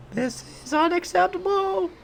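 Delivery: extremely high falsetto creature wail; screechy goblin outrage. Pretend. Streamer voicing outraged goblin boss, very high put-on screech.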